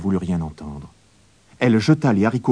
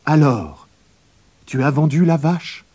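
higher, eager mother asking; mother's voice; 7 semitones higher, 4 dB louder than usual. A narrator's reading. Mother asks Jacques about selling the cow. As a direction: raise the pitch for the curious mother.

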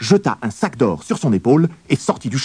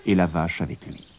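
plain reading voice

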